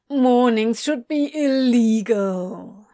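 slow sleepy whine; drawn-out grumpy whine; whining. A narrator's reading. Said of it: "he whined".

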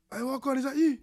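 high, excited mate's greeting; imitating excited youth. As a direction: call out like a surprised friend. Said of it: "He's like".

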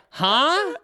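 high, puzzled huh; mock bafflement. Storyteller imitating girls asked about a photo, baffled high huh.

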